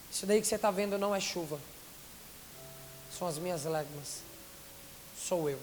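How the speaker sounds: voz interior de Deus. Retold or imitated. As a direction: let each phrase settle, low and unhurried. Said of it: "eu ouço uma voz dentro de mim". (voz de Deus, baixa e pausada)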